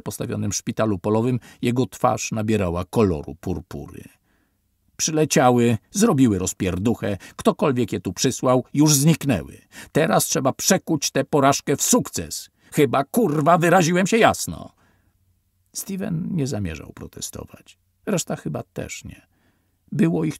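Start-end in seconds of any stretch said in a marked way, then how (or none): 5.0-14.7 loud, higher, furious tirade; angry officer. A narrator's reading. Officer berating the twelve survivors, angry loud rant. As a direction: rage at them, daring anyone to object. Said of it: "wykrzykiwał groźby".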